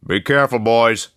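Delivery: calling after them. A narrator's reading. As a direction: call it after them down the hall. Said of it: "Lauderman called after us".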